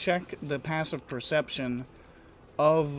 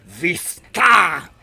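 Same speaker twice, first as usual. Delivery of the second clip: loud, high barked orders; commanding devil shout; 4 semitones higher, 11 dB louder than usual. Acted. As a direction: bark the orders, each louder. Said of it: "points at them and says".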